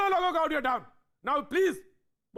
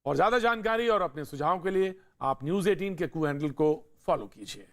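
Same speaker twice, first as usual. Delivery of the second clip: lower, composed presenter read; news anchor sign-off; 5 semitones lower than usual. Real, not acted. Anchor gives viewers the day's question and signs off, composed and lower. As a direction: close the show in a measured anchor voice.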